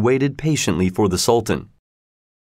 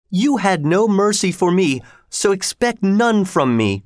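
merciless sorceress; 9 semitones higher than usual. A narrator's reading. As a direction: voice a cruel queen, pitched well up. Cruel sorceress rebukes whipped king.

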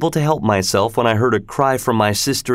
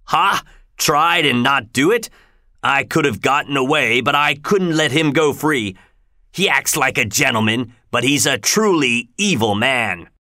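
raised, scornful convict voice; 3 semitones higher than usual. Acted. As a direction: scoff at the other man.

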